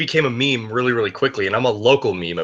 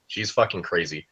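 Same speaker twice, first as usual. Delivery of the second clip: ordinary talk, nothing marked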